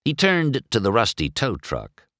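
ordinary delivery, nothing marked

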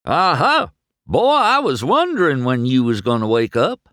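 a chuckling country tow truck; folksy tow truck. Acted.

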